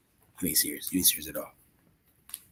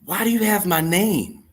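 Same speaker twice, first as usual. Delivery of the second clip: normal talking voice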